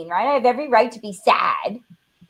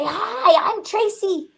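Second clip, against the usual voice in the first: put-on higher, bright kid voice; little girl asking; 8 semitones higher, slower than usual. Tracy voicing her child self to the kids, higher. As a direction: sound like a small girl asking to join. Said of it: "and say".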